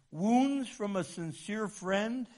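ordinary talk

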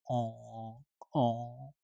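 On said through the nose, like with nose blocked; nasal on. Shown as a demonstration. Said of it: "So it's very nasal".

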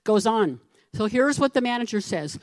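normal talking voice

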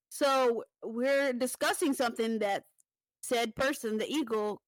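usual speaking voice

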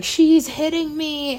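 high, loud, drawn-out mock cry for help; mock whiny cry. Drunk friend calling to her brothers, high mock wail.

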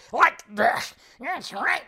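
put-on slow, lightly grizzled old-man voice; a hint of a growly aged actor; Nick Nolte impression. Shown as a demonstration. Host demoing how Nick Nolte talks, lightly growled. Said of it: "my Nick Nolte impression".

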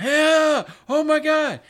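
high, loud whooping bar crowd; a burst of barroom whoops; mock crowd cheer.

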